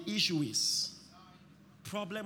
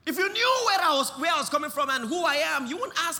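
higher and louder, pushing faster; loud preaching rebuke; 7 semitones higher, 8 dB louder, faster than usual. Acted. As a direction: rebuke the doubter at full preaching force. Gabriel's rebuke to the doubter.

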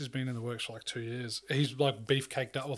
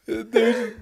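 normal talking voice

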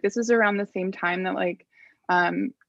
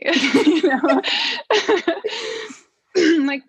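laughing; 9 semitones higher, 5 dB louder, much slower than usual. Real, not acted. Laughs about her fit pregnancy.

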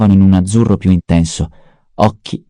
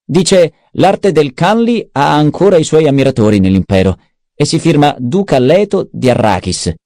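Peter the mentat; 6 semitones higher than usual. A narrator's reading. Mentat Peter reporting to the baron, high and sneering. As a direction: needle the baron in a high mocking tone. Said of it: "chiese il barone".

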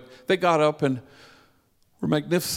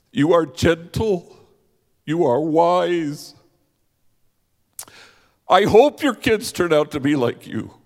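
louder, higher, tearful insistence; woman answers Mario, crying and much louder; tearful reply; 4 semitones higher, 7 dB louder than usual. Retold or imitated. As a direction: press each kindness on him through tears. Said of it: "now it's her turn to cry".

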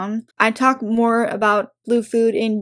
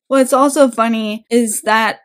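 normal talking voice

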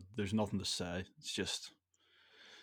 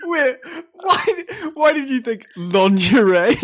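loud, laughing question; real laughter, much louder and higher; laughing while asking; 14 semitones higher, 11 dB louder, slower than usual. Real, not acted. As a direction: ask through real laughter, slow. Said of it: "laughing at story".

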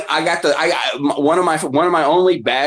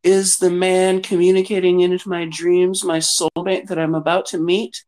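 high, dreamy client voice, pitched up; mock client; 6 semitones higher than usual. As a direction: imitate the offended client pleading for love. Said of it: "she's like".

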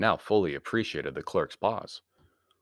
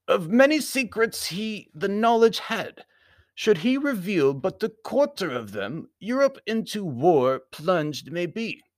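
high, louder, slow warning; foreign Baron; 12 semitones higher, 5 dB louder, slower than usual. A narrator's reading. Baron warning of war in Europe, high formal voice. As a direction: warn gravely in a high formal voice.

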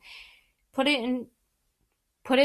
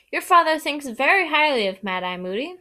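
sternly defending, a bit higher; stern mother scolding; 6 semitones higher, 6 dB louder than usual. A narrator's reading. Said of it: "said Missus Weasley sternly".

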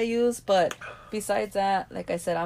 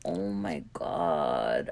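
low, slow, drawn-out groan of dismay; retold dismay; 6 dB quieter, much slower than usual. Host replays being found out at work, dismayed. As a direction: drag it out low, mock mortified.

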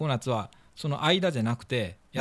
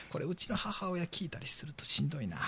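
whispered aside; 11 dB quieter than usual. Real, not acted. Aside worried mother might listen.

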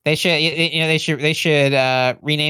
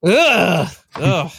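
disgusted groan; 4 semitones higher, much slower than usual. Real, not acted. Disgust at named countries.

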